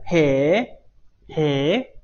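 long "heee?" with a swooping rise; demonstrated astonishment. Shown as a demonstration. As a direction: draw out a very surprised question. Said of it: "非常吃惊的感觉".

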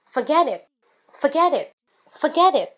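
FORGET hit high and loud, falling off; emphatic drill. Shown as a demonstration. Teacher drilling the class, emphatic forget it. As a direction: punch forget and drop it. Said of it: "Number one".

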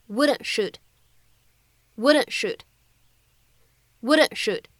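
"wouldn't shoot" with the t of "wouldn't" held, no release; unreleased t. Shown as a demonstration. Teacher repeating incomplete plosion for students, "wouldn't shoot". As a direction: swallow the t and glide into "shoot". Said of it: "存在一个不完全爆破的处理".

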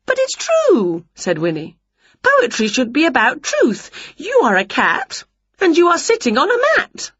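high, indignant insisting; insistent witch. A narrator's reading. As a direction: protest like a stubborn witch.

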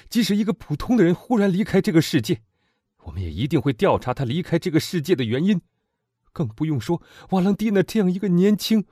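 put-on loud, high, fervent young man; impassioned young man. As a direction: demand revenge with burning passion. Morrel's impassioned plea. (激昂的青年)

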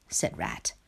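ordinary delivery

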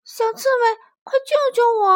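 high, loud plea for help; frightened little mole. A narrator's reading. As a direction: call out in fright to a friend. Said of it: "小鼹鼠害怕极了".

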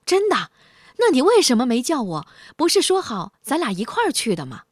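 high, loud startled questions; startled cat. A narrator's reading. Cat learns the meeting is over, startled high shout. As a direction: jolt up in shock, then press him. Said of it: "猫吃了一惊".

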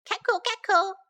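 high-pitched hen cackle; mother hen. A narrator's reading. Mother hen greets the hedgehog, clucking. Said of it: "the mother hen said".

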